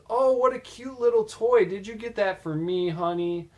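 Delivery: high, sweet girlfriend voice imitated; a boyfriend's girl cooing over a cute toy, mildly mimicked.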